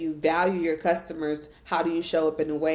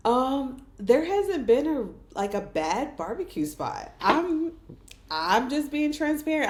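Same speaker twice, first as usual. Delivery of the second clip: higher, sweet pageant contestant voice; poised pageant answer; 7 semitones higher than usual. Shown as a demonstration. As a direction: answer like a beauty queen. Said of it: "have to put my, uh, pageant voice on".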